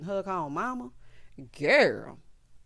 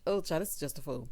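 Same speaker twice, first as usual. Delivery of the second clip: ordinary talk, nothing marked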